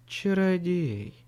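slow languid breathy sigh; breathy Hollywood starlet. A narrator's reading. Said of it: "томно вздохнула Мэйв".

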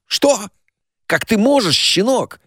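high-pitched burst of indignation; outraged. A narrator's reading. Stepfather rounding on the narrator, outraged. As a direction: exclaim in outraged disbelief, pitched well up.

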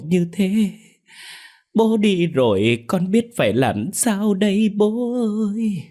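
high, slow, overdone wailing; scheming son pretending to mourn his father; fake crying. A narrator's reading. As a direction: ham up a sobbing act with no real tears. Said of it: "giả vờ khóc lên khóc xuống".

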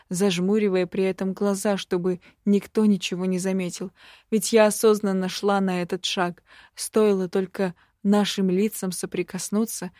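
usual speaking voice

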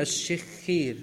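الشخير as ash-shikh-kheer, both consonants doubled; doubled shin and kha. Shown as a demonstration. Teacher corrects student's reading, careful doubled consonants. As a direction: hold the sh and kh of الشخير, i after each. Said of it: "بتشديد الشين وكسرها".

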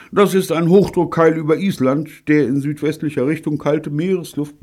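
high, pompous explanation on one held pitch; know-it-all lecture. A narrator's reading. Bunny explaining the cold weather to the crew, know-it-all. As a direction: lecture them from high up, unbroken.

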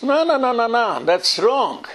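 high-pitched, drawn-out protest; high, insistent objection; emphatic objection. Acted. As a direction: wave it away with a high run of no's. Rejecting a practice as wrong.

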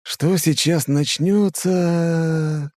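slow and drawled; drawn out. Acted. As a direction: stretch every word. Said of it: "тяну я". (протяжно)

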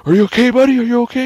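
loud, high-pitched acted concern; urgent calling out. First responders to fleeing Lucky, urgent mock calling out. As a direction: call out in mock alarm. Responders call to screaming survivor.

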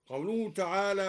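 ordinary talk

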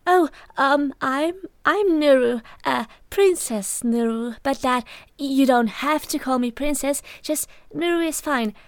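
a blushing princess who can't stop talking; flustered blabbing. A narrator's reading. Shy princess introducing herself to the table, flustered blabbing. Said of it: "her blabbing mouth".

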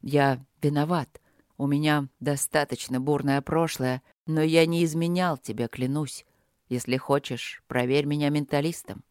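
low, softened male confession; almost calm man. A narrator's reading. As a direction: explain calmly in a man's voice. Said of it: "проговорил он почти спокойно".

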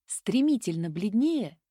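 ordinary delivery

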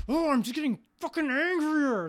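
mock furious rant. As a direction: blow up in a hammed-up rage. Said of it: "gonna get people like".

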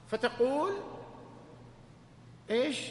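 normal talking voice

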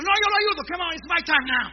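high, loud pushy helper; hammed-up rival helper insisting; eager insisting. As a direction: elbow in, it's your turn. Another helper competing to help.

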